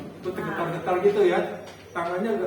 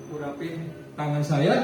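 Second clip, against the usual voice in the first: usual speaking voice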